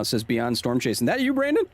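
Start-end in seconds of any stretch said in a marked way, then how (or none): 1.1-1.6 high, questioning call to a friend; surprised call. Real, not acted. Streamer spotting a familiar character, high surprised call. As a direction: perk up and call out his name.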